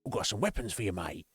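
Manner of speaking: mock cockney merchant patter; remake merchant. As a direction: hawk weapons like a cheeky London geezer. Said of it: "He just sounds like".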